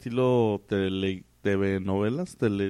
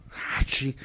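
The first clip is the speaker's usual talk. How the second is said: ordinary talk, nothing marked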